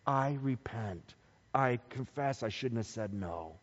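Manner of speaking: low, soft repentance; subdued, acted-out contrition; sorrowful confession. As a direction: confess it quietly and humbly. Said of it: "being sorrow for sin".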